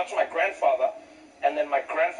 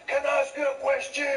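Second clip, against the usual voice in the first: grandfather's voice; 11 semitones higher than usual. Acted. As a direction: call out deferentially as an old grandfather. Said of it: "my grandfather turns to the cop".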